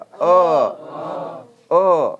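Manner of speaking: अ and ओ pushed hard from the throat; forced from throat. Shown as a demonstration. Teacher shows where throat sounds come from, forceful throaty अ, ओ. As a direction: hawk the vowels अ, ओ out loudly. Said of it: "खकास के बोलिए".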